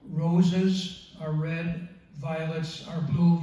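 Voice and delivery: very slow, each beat marked; sing-song roses are red, spelled out slowly; slow nursery-rhyme cadence; rhythmic recital. Shown as a demonstration. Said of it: "You have a two, two syllable followed by".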